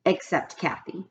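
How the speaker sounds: ordinary delivery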